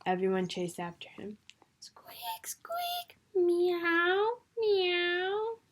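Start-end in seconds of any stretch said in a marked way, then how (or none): 1.8-3.2 high whispered squeaks; the Dormouse squeaking as everyone chases him; mouse squeak. A narrator's reading. 3.3-5.6 high, rising meows; the cat meowing in the chase. A narrator's reading.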